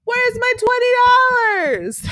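high, slow shout after a leaving friend; calling after him. Retold or imitated. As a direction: yell it across the street, drawn out. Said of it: "Klaus is like".

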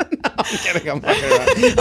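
laughing. Real, not acted. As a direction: crack up while taking it back. Laughing off her own teasing.

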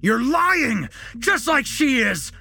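high, loud, wild accusation; unhinged scream. A narrator's reading. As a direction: scream it as if losing all control. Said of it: "Jesse screamed".

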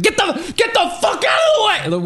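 very high screamed imitation; hammed-up, high-pitched yell from a driver; road-rage shout. Acting out road rage at pedestrian.

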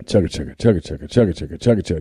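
low chugging train noise. Retold or imitated. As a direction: chug like a toy train. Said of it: "he pushes the lever down".

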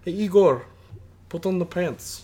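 high, loud mock Russian accent; Russian wife mock accent. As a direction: playfully imitate a Russian wife. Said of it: "Oh, she was Russian?".

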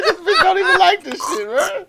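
high, loud mock complaint; frustrated man voice. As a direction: whine like a let-down lover. Voicing frustrated partner.

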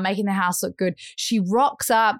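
ordinary delivery

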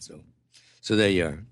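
ordinary talk, nothing marked

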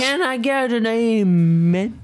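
put-on rally the crowd drunk; slow drunken church call. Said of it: "In my drunk voice".

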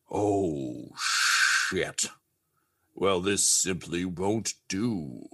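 displeased Santa. Acted. Santa figure voiced by game master.

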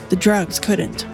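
ordinary delivery

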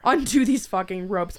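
loud, furious order; angry demand. Acted. Tied-up captive yelling at her captor to untie her. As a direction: bark it at him, fed up.

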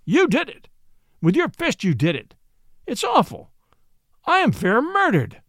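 high-pitched mock outrage; crying out. A narrator's reading. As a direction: play the wronged victim in high exclamations. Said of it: "cried Watson".

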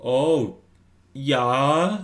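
high, drawn-out bluffing yeah; put-on radio voice. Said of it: "it's my yarp".

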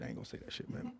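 breathy whisper to himself. Real, not acted. Streamer muttering to himself off-mic, whispered. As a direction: hush it like a secret aside. Self-censoring, repeats it hushed.

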